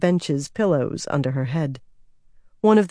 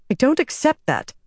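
higher, pushing back in frustration; frustrated; 9 semitones higher than usual. Acted. Nynaeve to Rand over the dead boy, frustrated protest. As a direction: refuse it, voice climbing in frustration. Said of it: "Nynaeve said, frustrated".

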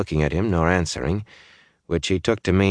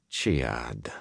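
odd tone; 5 semitones lower, 8 dB quieter than usual. A narrator's reading. Odd tone repeated name.

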